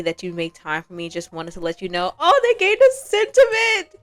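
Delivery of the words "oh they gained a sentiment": high, loud thrilled squeal; giddy high cry; excited squeal. Real, not acted. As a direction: shriek with delight at the news. Thrilled at game notification.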